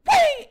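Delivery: sharp high sound effect of a fastball; mock whoosh of a pitch. Pitcher throwing at Tracy, a high loud whoosh. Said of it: "Roger Clemens goes".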